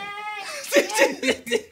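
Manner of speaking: sudden squealing laugh up high; high shrieking laugh. Real, not acted. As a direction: burst into a shrill laugh. Laughing while baby cries.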